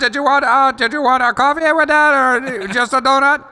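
high, bright service-window voice, up a lot; drive-thru worker voice. Pretend. Coworker playing a drive-thru clerk in a skit, high and chirpy. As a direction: play the cheery Dunkin' clerk at the speaker box. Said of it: "I was not doing that accent".

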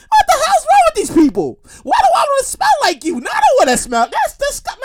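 high, loud comic rant; hammed-up high-pitched disgust; mock disgusted visitor. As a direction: squeal in outrage at the stink. Visitor rants about the smell.

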